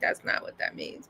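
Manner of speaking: a whispered correction. Retold or imitated. Correcting her dad's emoji use, whispered. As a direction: whisper it flatly. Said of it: "And I'm like".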